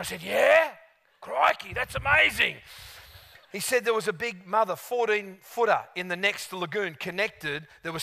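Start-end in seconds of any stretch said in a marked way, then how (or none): 0.2-2.6 higher, louder Steve Irwin Australian "Crikey"; hammed-up Steve Irwin Australian enthusiasm. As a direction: gush like Steve Irwin at a croc. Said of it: "We're both talking like Steve".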